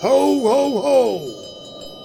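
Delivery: jolly Santa laugh. Acted. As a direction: boom it out like a department-store Santa. [name] playing Santa.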